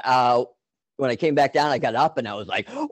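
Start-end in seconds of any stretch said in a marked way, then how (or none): 2.7-2.9 elated whoop. Retold or imitated. Tripper retells coming back down, exhilarated whoop. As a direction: let out a big whooping cheer.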